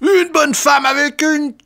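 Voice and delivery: very high, slow howl. A narrator's reading. Lecturer howls while trying to dodge the blows, high and drawn-out. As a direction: howl it out, stretched and pitched far up. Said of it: "hurla Kanonov".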